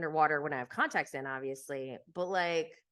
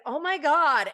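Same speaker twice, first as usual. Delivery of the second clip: mock dread; 8 semitones higher, 8 dB louder than usual. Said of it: "I'm just like".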